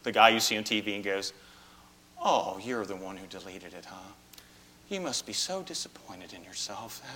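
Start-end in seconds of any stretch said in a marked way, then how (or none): 2.2-7.0 humbly. Retold or imitated. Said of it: "And very humbly".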